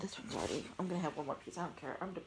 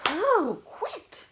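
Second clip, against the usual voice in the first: high, loud outburst after a sneeze; exasperated cry; 12 semitones higher, 12 dB louder than usual. Real, not acted. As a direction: wail at the sneezes to stop.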